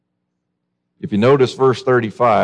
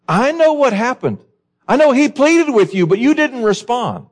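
king's voice, much higher; indignant king; 11 semitones higher than usual. Acted. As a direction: confront the unforgiving servant. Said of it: "He says".

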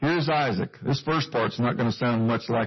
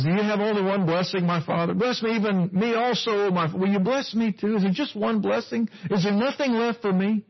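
higher, desperate begging retold; pleading Esau; 8 semitones higher than usual. Preacher acting out Esau's plea to Isaac, pleading high. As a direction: plead like a son left empty-handed. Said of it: "if you notice the way he says it".

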